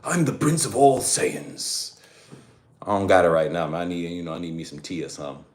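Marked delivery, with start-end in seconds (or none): 0.0-2.0 slow, lightly voiced proud prince; a hint of the arrogant Saiyan prince; Vegeta voice. Shown as a demonstration. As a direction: announce your royalty slowly. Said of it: "voiceovers that I did of Dragon Ball Z".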